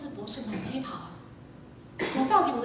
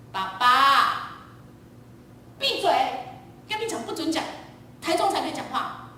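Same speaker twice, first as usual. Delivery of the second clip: high, loud, snapped command; sharp shushing; 4 semitones higher, 4 dB louder than usual. Retold or imitated. Child reminding the father no talking until Taichung, sharp. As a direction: cut someone off sharply.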